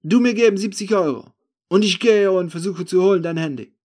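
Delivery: high, loud broken-German street deal; haggling thief. Acted. Thief offering to fetch the phone, haggling.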